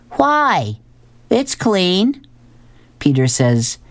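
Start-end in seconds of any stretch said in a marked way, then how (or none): 0.1-2.2 put-on much higher, indignant child's protest; high-pitched innocent child voice; whiny kid. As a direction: defend the messy room like a kid. Kid Elliot protests his room.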